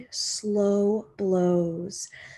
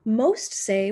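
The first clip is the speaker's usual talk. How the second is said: plain reading voice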